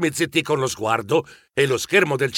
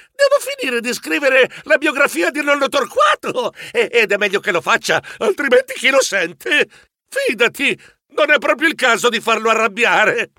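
high, anxious babble; comic panic, easing slightly toward the end; terrified pleading; 6 semitones higher than usual. A narrator's reading. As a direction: beg nervously for your life. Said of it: "Io gridai, terrorizzato".

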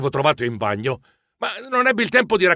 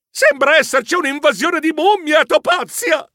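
high-pitched cry of alarm; alarmed exclamation; 11 semitones higher than usual. A narrator's reading. Narrator warns friends of the invasion, alarmed. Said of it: "esclamai in allarme".

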